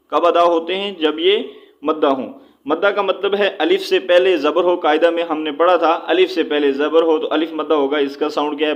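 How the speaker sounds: ordinary talk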